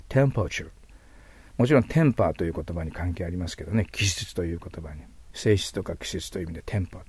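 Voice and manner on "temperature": Temperature as "TEM-pr-cher", high first syllable, blurred middle; model pronunciation. Shown as a demonstration. Teacher repeats the word after tongue advice, model pronunciation. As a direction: stress the start of Temperature and keep the r vague. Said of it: "和は曖昧ですから".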